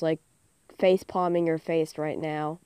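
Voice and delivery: usual speaking voice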